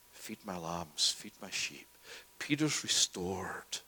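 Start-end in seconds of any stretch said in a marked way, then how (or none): 0.1-1.9 gentle charge. Acted. Jesus restores Peter.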